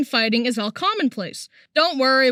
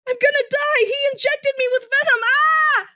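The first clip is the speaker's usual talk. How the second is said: high, panicked cries; freaking out; 12 semitones higher than usual. Retold or imitated. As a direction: shriek in sheer panic. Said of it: "I started freaking out".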